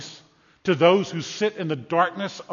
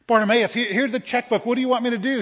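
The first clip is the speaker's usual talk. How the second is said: higher-pitched, urgent offer; acted, raised-pitch generous offer; eager offer; 6 semitones higher, 4 dB louder, faster than usual. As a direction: press the offer on him. Acts out Jesus offering a checkbook.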